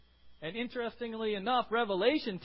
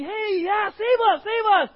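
much higher, louder whooping cheer; jubilant cheering; 11 semitones higher, 15 dB louder, slower than usual. Retold or imitated. Preacher voicing the thrilled crowd greeting Jesus, jubilant shouting. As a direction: cheer like the liberator has come. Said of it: "a mixture of a plea".

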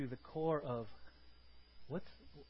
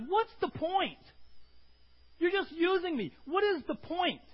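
high, loud whiny protest; imitating the angry Karate Kid complaining; frustrated complaint; 13 semitones higher, 8 dB louder, slower than usual. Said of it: "He goes".

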